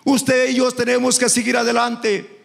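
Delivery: preacher chanting over the music; fervent preaching. Acted. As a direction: lift it into a sung, rousing preach. Preaching builds to chanted climax.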